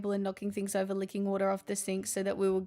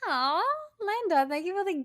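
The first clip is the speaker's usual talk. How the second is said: high cooing aw, much higher; 9 semitones higher than usual. Real, not acted. Streamer thanking a gifted sub, cooing. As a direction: coo at the gifter fondly.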